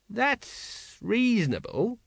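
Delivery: high, slow, hesitant admission; slow, grudging agreement. A narrator's reading. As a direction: stretch it out, giving in slowly. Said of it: "said Colon eventually".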